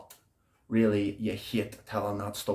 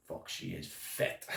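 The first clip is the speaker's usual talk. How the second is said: breathless, much softer whispered praise; awed whisper; 5 semitones lower, 14 dB quieter than usual. Acted. Prisoner tells cellmate about his girl, whispered in awe. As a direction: hush it in awe.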